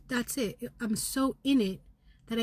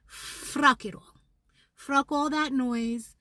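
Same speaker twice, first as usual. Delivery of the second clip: higher, slower, pushed out in anger; defiant rage; 5 semitones higher, 4 dB louder, slower than usual. Real, not acted. Venting to viewers, slow defiant anger. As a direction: spit it out like you're done. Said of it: "I am rageful".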